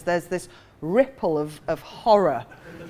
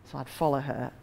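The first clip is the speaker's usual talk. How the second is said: usual speaking voice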